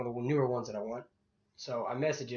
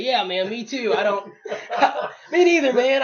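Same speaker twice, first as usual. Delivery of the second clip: laughing mock agreement; 9 semitones higher, 10 dB louder than usual.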